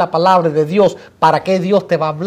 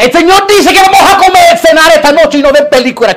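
high, loud mock-pious outburst; bossy spiritual girlfriend; 8 semitones higher, 6 dB louder than usual. Girlfriend overruling boyfriend's movie plan, high loud decree. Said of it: "Mi amor, yo quería ver una película".

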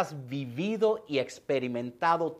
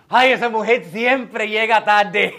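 mock gossip; 4 semitones higher, 9 dB louder, slower than usual. Mocking gossiping churchgoer.

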